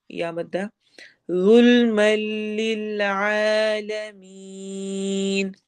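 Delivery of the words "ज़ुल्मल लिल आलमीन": very slow chant with a heavy ज़ु; Quranic recitation. Shown as a demonstration. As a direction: draw out each word slowly. Teacher recites Quranic verse.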